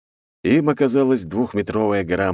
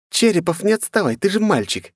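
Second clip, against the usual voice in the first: high teasing schoolgirl voice; giggly girl; 11 semitones higher than usual. A narrator's reading. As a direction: tease him, high and giggly. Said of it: "хихикала одна из девушек".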